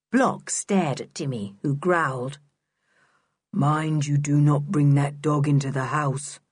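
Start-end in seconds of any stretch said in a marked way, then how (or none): 3.5-6.4 toneless warning on one note; expressionless, monotone character voice; curious monotone. A narrator's reading. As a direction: drone the order without any inflection. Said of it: "in his curious monotone of a voice".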